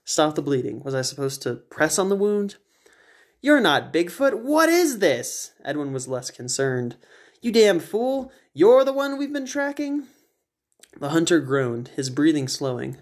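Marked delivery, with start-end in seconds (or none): 3.4-5.5 slow, much higher, strained; pained hunter. Acted. Wounded hunter questioning the narrator, in pain. 7.4-10.1 much higher, scornful; eccentric Edwin. Acted. Edwin scolding the shot hunter, scornful high voice.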